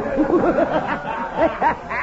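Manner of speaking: high, delighted chuckle; hearty laugh. Acted. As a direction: laugh at his own joke. Pancho laughs at himself.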